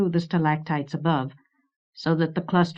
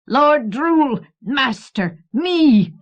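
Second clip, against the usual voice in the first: very high, loud boasting creature; mad pride; 8 semitones higher, 6 dB louder, much slower than usual. A narrator's reading. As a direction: crow your own title with crazed pride. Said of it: "capering with mad pride".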